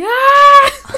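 high loud pain scream; retold scream. Reliving labour pain to the interviewer, high scream. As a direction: let out a piercing cry of pain. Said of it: "je commence à gueuler".

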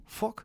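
fork said without R, high, like "fuck"; R dropped. Retold or imitated. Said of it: "ils prononcent pas le R".

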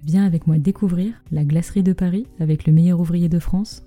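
ordinary talk

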